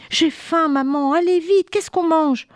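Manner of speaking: put-on high whiny child's voice; a little boy pestering for food; hungry child. Léo réclame à manger. (petit garçon affamé, voix aiguë et pressante)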